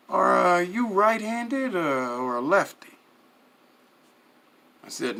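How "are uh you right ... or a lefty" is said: boss asking. A narrator's reading. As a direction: draw it out, probing the captive. Boss questions the captive.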